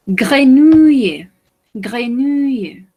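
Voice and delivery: "Grenouille" high, loud and slow; teacher models "grenouille" with big emphasis; slow emphatic model. Shown as a demonstration. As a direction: stretch out "grenouille" for the class. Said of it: "teacher modelling a word".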